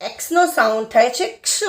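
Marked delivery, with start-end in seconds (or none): 1.4-1.6 ક્ષ as "ksuh", K and S joined; phonic X sound. Shown as a demonstration. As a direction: sound out ક્ષ with ક and સ run together. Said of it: "ક અને સ ભેગો". (ક્ષ માં ક અને સ ભેગો ઉચ્ચાર)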